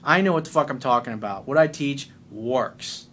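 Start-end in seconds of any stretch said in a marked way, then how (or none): none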